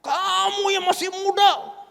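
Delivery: mock stern rebuke from the pastor; angry rebuke. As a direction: scold the young one from above. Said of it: "Dia marah".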